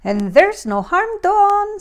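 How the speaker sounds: high, loud, friendly bear chorus voice; reassuring bears. A narrator's reading.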